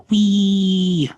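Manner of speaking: shouted high wee; loud gleeful wee. Pretend. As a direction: yell it as you swing up. Swinging higher, louder wee.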